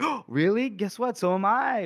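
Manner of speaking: high, delighted surprise; acted girlish excitement. Finree answering Gorst's news, high excited reply.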